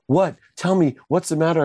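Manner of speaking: high, urgent imitation of a mother; mock alarm, pitched well up; retold worried mom. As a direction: press anxiously for an answer as mom. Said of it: "my mom gets terrified".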